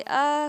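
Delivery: short high mock scream of shock; a girl's quick startled shriek, imitated; retold scream. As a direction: let out a sharp startled "aa". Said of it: "वो चिल्लाती है जोर से".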